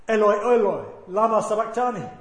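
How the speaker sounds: loud retold cry from the cross. Preacher recalling Jesus' cry of abandonment, crying out. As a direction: call it out forcefully. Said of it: "the one that cried out".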